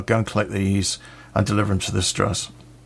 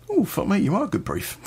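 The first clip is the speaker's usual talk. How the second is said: hushed mock astonishment; impressed reaction; 10 semitones higher than usual. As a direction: marvel to yourself, pitch jumping up then falling. His impressed thought about his brief.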